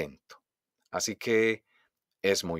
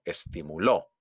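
"estimuló" punched on the last LÓ; stress on final syllable; 4 semitones lower than usual. Shown as a demonstration. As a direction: land on LÓ in "estimuló". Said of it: "hago un golpe de voz". ("estimuló" con golpe de voz en LÓ)

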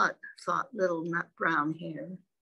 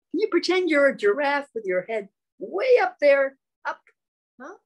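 playful coaxing; 7 semitones higher, 7 dB louder, faster than usual. Real, not acted. Parent coaxing baby to play giraffe, high and playful.

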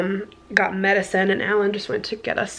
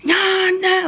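mock angry yelling; 10 semitones higher than usual. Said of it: "I'm not even gonna imitate it".

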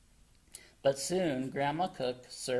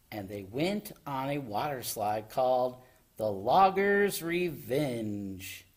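plain reading voice

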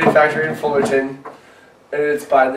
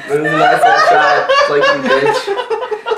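laughing. Real, not acted. Laughing high retelling.

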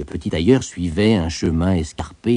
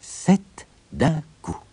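slow, higher, word by word with pauses; spelling out embroidered words; 8 semitones higher, 4 dB quieter, much slower than usual. A narrator's reading. Tailor embroidering the motto on his belt, word by word. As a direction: set each word down slowly, like stitching it. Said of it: "broda ces mots sur sa ceinture".

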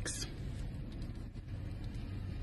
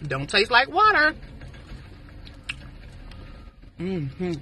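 talking between spoonfuls; mouth full of chili; 5 dB quieter than usual. Real, not acted. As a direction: spoon at the lips. Seen 0: Spoon with chili held at lips while she hums and talks.

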